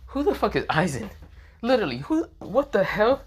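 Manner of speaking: high-pitched, incredulous questioning; acted bewilderment. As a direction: demand an answer in baffled disbelief. Acted bewildered reaction to a name.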